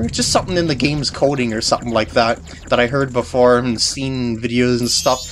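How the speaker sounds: ordinary delivery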